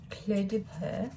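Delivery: English speaker's careful try at French; attempted French. Real, not acted. Unboxer reading a French brand name aloud, tentative French accent. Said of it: "Don't make fun of my French".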